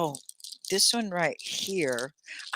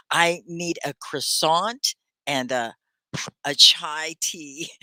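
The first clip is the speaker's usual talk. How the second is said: slow, carefully spaced drive-through order; slow model order; 3 dB louder, slower than usual. Pretend. Teacher playing a customer at the Starbucks drive-through, slow careful order. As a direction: model the order slowly for learners. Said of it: "need to know how to say it".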